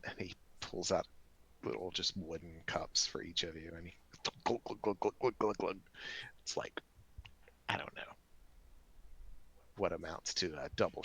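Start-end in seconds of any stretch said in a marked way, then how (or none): 4.4-5.8 quick imitated glugging noise; rapid glug-glug sound effect; pouring glugs. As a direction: voice a jug emptying into cups. Imitates liquor being poured into cups.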